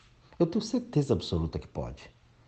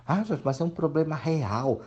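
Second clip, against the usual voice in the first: higher, whiny protest from a viewer; mock objecting viewer; 9 semitones higher than usual. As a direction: play the doubter pleading his case. (imitando um espectador que protesta, mais agudo)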